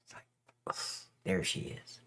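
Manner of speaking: breathy, dreamy whisper. Acted. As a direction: whisper it like you're smitten. Dreamy sigh over radio voice.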